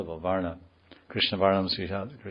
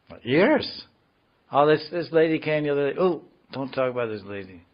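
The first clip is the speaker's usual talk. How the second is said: high, startled protest, hammed up; playfully scandalized devotee; mock alarm; 6 semitones higher, 4 dB louder than usual. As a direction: act aghast at a forbidden topic.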